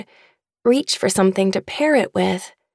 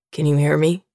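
a lower, gentle dad's voice; father asking his unconscious daughter, lower, gentle; fatherly voice; 6 semitones lower, faster than usual. A narrator's reading. Father speaks to waking daughter.

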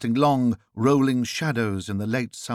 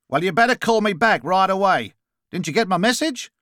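brusque Australian caller, higher and louder; Australian accent; 8 semitones higher, 5 dB louder than usual. A narrator's reading. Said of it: "noticing the Australian accent".

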